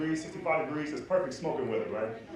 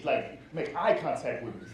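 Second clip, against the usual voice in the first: ordinary delivery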